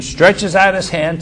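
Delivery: ordinary talk, nothing marked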